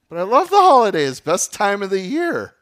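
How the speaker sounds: sarcastic sing-song delight; mock cheer. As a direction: gush like a holiday enthusiast.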